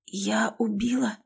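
a slow, hushed, hoarse question; hoarse whisper. A narrator's reading. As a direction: barely get the words out in shock. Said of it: "Сиплым голосом прошептала".

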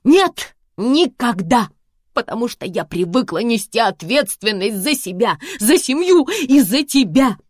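high, loud near-tears shout; tearful yell. Acted. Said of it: "Я уже плакала".

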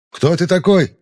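loud, high challenging call; shouting. Acted. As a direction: yell back across the distance. Enemy shouts back.

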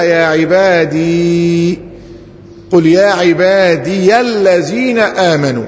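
slow "عبادي" held on a long ee, then "-diya" into "الذين"; restored ya. Shown as a demonstration. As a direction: stretch out the hidden ya of "عبادي". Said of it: "ياء محذوفة تقديرها". (إظهار الياء المحذوفة مع المد)

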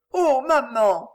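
put-on pleading girl. A narrator's reading. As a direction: plead with mama. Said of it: "supplia Suzanne".

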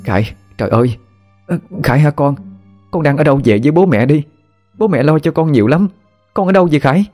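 a mother near tears begging her lost son; tearful frantic mother. Acted. Mother on the phone to her missing son, tearful and frantic. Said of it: "bà Hoa khóc bù lu bù loa lên".

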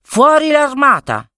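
command. Acted. Boy commanding the magic staff to release the army, high ringing command. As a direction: call out the order to the army. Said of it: "comandò".

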